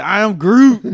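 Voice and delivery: loud, high, laughing Groot line; laughing Groot shout. Pretend. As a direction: shout it while cracking up. Joking Groot horror movie line.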